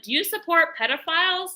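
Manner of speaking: pointed question. Retold or imitated. Confronting woman in car.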